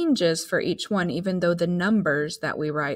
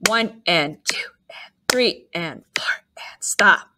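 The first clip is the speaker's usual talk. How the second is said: whispered rest counts; counting under breath. Shown as a demonstration. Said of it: "using our whisper count when we have a rest".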